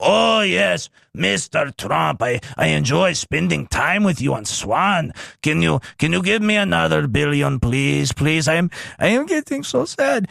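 mock pleading Ukrainian accent, lightly done; Zelensky impression. Streamer mocking Zelensky begging Trump, mock Ukrainian accent. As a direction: whine for money in a put-on Zelensky accent. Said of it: "I'm losing my Zelensky impression".

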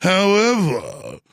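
slow, drawn-out fake British menace; mock posh British. Host mocks Gorka as a slow posh British snob. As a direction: imitate a pompous British threat. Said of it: "trying always to sound like Prince Charles".

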